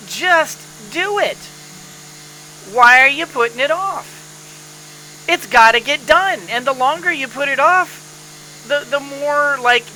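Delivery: ordinary delivery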